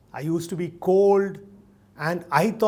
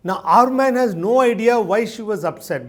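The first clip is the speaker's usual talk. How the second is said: ordinary talk, nothing marked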